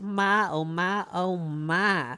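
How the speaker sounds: slow, drawling Southern belle voice. Acted. Streamer hamming up a Southern belle for chat. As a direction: swoon it out like a fainting Southern belle. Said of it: "Vera's a voice actress".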